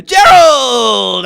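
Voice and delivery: loud, high, drawn-out shout of a name; calling out. Real, not acted. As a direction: holler the name as a long call. Sudden loud call to someone.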